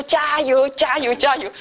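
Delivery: high, lively cheering. Retold or imitated. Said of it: "一直以为他们上面说". (提高音调喊加油)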